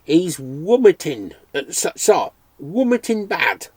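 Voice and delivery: slow, lightly voiced Irish brogue; an old Irishman describing his sick dog; hint of an Irish brogue, slow; Irish accent. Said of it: "rich, unexpected accent".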